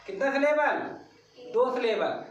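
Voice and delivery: usual speaking voice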